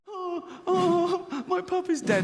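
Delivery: high, soft mock sobbing child; hammed-up crying. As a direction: whimper like a child faking tears. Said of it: "puppy dead scene".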